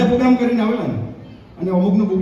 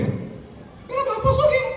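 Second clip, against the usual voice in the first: very high, slow put-on voice; squeaky mock listener; 4 dB quieter, much slower than usual. Comedian voices a heckler asking, high and slow. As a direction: pipe it up slow like a cartoon kid.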